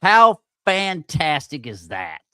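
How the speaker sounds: fed-up mock praise, slow and loud; sarcastic. Real, not acted. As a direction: drag it out with biting sarcasm. Editor greys out his edit.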